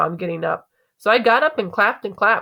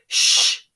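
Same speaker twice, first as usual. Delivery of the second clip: sharp voiceless shush; 3 dB louder than usual. Acted. Hushing someone at the play, shush. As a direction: hush someone with a long sh.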